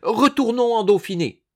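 loud, high-pitched order; commanding. A narrator's reading. Madame turning to her husband, ordering shrilly. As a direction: order it imperiously, in a huff. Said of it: "elle ordonna".

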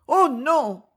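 high-pitched cry of protest; cried out. A narrator's reading. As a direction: cry out in sudden distress. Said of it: "s'écria-t-elle". (cri de refus, aigu)

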